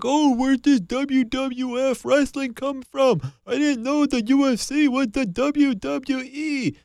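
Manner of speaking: high, slow whiny casual fan voice; high drawn-out mocking fan voice; mock clueless casual fan. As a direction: play a confused fan sneering at wrestling. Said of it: "the casuals came out in full force like".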